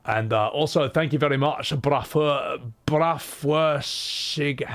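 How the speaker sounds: normal talking voice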